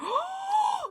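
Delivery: sharp high gasp of realization; excited gasp. Real, not acted. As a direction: gasp as the idea hits. Sudden realization while browsing.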